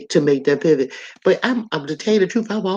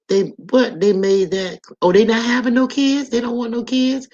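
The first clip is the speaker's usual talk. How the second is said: higher and louder, caught off guard; shocked disbelief; 7 semitones higher, 4 dB louder than usual. Real, not acted. Reading a viewer comment, she reacts with startled disbelief. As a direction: gasp out the question, stunned.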